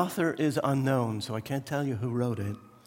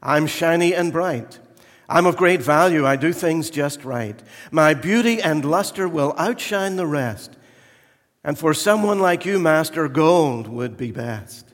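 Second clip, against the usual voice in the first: boastful gold vessel; 3 semitones higher, 8 dB louder than usual. Acted. Gold vessel brags to the master, loud and boastful. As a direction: brag about your shine. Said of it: "cried the gold one".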